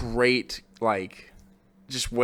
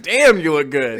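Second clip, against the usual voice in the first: imitating admiring ogle; 3 semitones higher, 6 dB louder, slower than usual. Imitating Paloma's admiring look.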